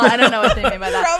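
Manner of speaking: mock ignorance through laughter; laughing. Real, not acted. Joking about "girlfriend", laughing.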